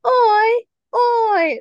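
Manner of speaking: high, loud mock posh-Cockney call; posh-Cockney hybrid accent. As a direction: blend posh and Cockney in one drawn-out cry. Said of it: "she's doing both at the same time".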